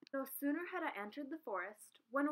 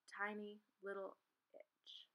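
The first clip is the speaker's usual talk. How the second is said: whisper; 3 semitones lower, 8 dB quieter, slower than usual. A narrator's reading. Suspense whisper.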